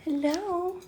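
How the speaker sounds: high sing-song hello; high greeting to bird. Real, not acted.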